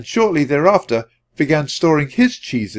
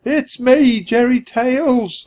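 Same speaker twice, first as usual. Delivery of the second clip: high squeaky cousin voice; 11 semitones higher, slower than usual. Acted. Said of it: "the voice continued".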